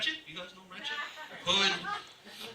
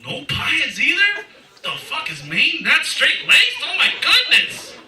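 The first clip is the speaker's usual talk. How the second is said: much higher, slow, louder disbelief; mock disbelief; 10 semitones higher, 4 dB louder, slower than usual. Real, not acted. Asking the crowd about potheads in higher, slow mock shock. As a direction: act scandalised at the crowd. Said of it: "mock shock at audience".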